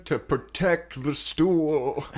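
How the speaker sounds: put-on slow, high old guardian voice; solemn old man, drawn out. Great-grandfather voiced, slow.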